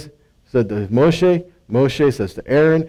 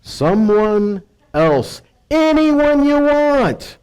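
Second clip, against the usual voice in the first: slow, drawn-out plea, rising high at the end; reluctant prophet pleading, voice climbing high; pleading Moshe; 6 semitones higher, much slower than usual. Acted. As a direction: beg the Lord to send someone else, stretching it out. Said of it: "But he replied".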